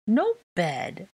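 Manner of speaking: put-on high small-child refusal; high-pitched bedtime whine; whining child. A narrator's reading. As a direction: protest it like a whiny little kid. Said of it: "Aurelian whined".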